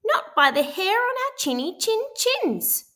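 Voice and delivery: very high squeaky pig voice; squealing little pig. A narrator's reading. Pig refuses the wolf at the door, high squeal. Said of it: "squealed the three little pigs".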